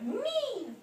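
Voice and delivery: a high, eager shout of me; eager yell. Acted. Morris blurting his answer to Boris's riddle, high eager yell. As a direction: blurt it out, sure you know the answer. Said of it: "Morris yelled".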